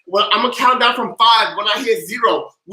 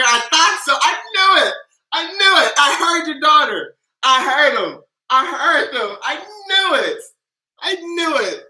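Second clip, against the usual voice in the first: much higher, gleeful I knew it; thrilled to be right; delighted; 6 semitones higher than usual. Real, not acted. Delighted at hearing the kid.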